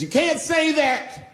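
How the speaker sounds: high-pitched, drawn-out mock wail; imitating crying woman. Crying audience member objects to comedian's jokes, imitated tearful outburst. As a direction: stretch it out high, mimicking a tearful protest. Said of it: "she was crying".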